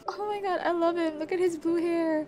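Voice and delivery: high-pitched fangirl swooning; giggly, lifted voice gushing over an idol; giddy adoration. Real, not acted. Reaches favourite member's photos.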